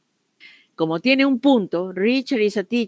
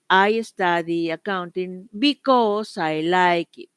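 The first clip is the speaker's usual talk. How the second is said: slow model answer; slower than usual. Shown as a demonstration. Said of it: "o alguien me dirá".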